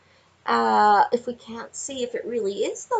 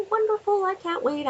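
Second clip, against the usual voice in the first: giddy gush; 10 semitones higher than usual. Acted. Gushing over the reading.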